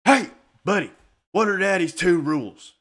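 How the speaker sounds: imitated parent's loud, punchy prompt; dad prompting his son. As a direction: call out like a dad reminding a crying kid. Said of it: "Elijah looks over and says".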